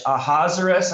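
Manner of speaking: "Ahasuerus" in four syllables, rhyming with Lazarus. Shown as a demonstration. Teacher showing English pronunciation, Ahasuerus as a-HAZ-er-us. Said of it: "I think of Lazarus".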